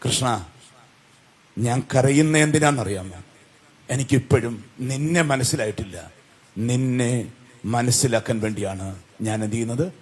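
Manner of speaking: low, soft reply with tears held back; lightly acted sorrow, hushed and low; tearful Kunti. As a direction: voice an understated, tearful plea, low and quiet. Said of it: "നിറക്കണ്ണുകളോടെ കൃഷ്ണനെ കുന്തി ഒന്ന് നോക്കിയിട്ട്".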